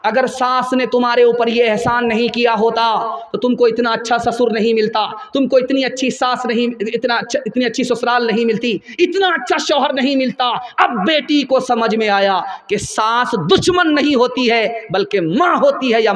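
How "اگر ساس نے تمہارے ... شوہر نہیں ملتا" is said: raised, stern warning from the mother; mother's warning. Acted. Mother warns her daughter, raised stern call. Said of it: "اب ماں نے کہا کہ".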